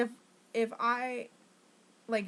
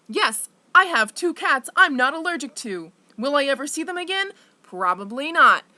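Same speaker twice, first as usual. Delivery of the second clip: much louder, higher, fast and bitter; bitter outburst; 5 semitones higher, 9 dB louder than usual. Real, not acted. Ranting about losing her cats, loud bitter indignation. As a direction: spit it out in indignation.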